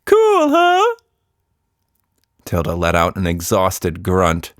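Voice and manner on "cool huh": high, loud goofy monster voice; a silly creature fishing for praise; proud silly monster asking for approval. Acted. Monster boasting about gadget.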